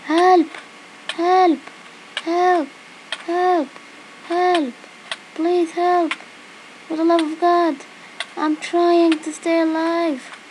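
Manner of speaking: high, loud cries for help; desperate calling out; panicked. Real, not acted. Drowning in game, calling for help.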